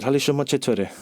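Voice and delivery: "Hali shoma chetore?" said as a Farsi greeting; سلام گرم به فارسی. Retold or imitated. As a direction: speak it in Farsi. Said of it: "And I said".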